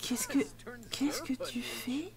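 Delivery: slow, soft suspicious whisper; whispering at a Sim caught flirting. Real, not acted. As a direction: breathe it out in a hush.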